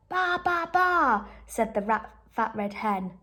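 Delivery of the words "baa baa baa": high bleating baas; rat baaing. Acted. Rat pretending to be a sheep, high baas.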